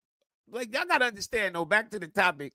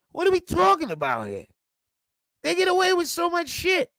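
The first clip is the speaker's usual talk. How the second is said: ordinary talk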